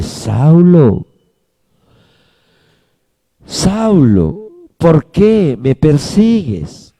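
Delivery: tender, unhurried question; with love. Acted. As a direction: call him lovingly, without reproach. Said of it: "con amor".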